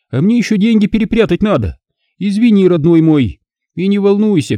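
high, gloating taunts; openly mocking Alisa. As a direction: jeer at him, delighting in it. Alisa mock farewell.

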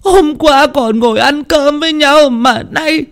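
very high, drawn-out mourning wail; heart-rending wailing. Acted. Widow crying over her just-dead husband, heart-rending wailing. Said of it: "òa lên tiếng khóc".

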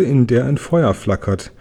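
ordinary delivery, nothing marked

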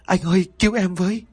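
high, frightened begging for help; cling and beg in panic; wife sobbing into her husband's arms, pleading in terror; terrified plea. Acted. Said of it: "chị liền lao tới ôm rồi òa khóc".

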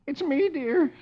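a hint of a hammed-up sob to wife; tearful Dagwood. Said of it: "Dagwood, tears in his eyes, says".

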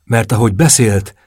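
plain reading voice

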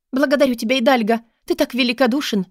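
high, eager thanks; fervent high-voiced young woman; grateful Katarina. A narrator's reading.

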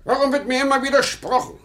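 high, peevish complaint; irritated outburst. Acted. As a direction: snap back, stubborn and cross. Husband snaps over cheese.

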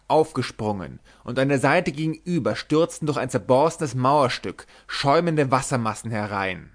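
usual speaking voice

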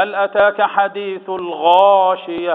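slow chanted verse with a heavy غا; recited demo. Shown as a demonstration. Sheikh demonstrates the verse to the class, slow chant. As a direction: model the verse slowly, leaning on the heavy غا. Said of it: "يبقى هنا إيه؟".

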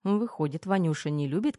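plain reading voice

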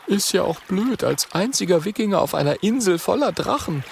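put-on quick, high boyish character voice; Oskar character voice. A narrator's reading.

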